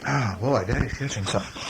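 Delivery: usual speaking voice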